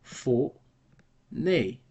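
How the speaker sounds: "four, nay" in two separate pieces; syllable by syllable. Shown as a demonstration. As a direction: break "four, nay" apart with a clear pause. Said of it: "two syllables".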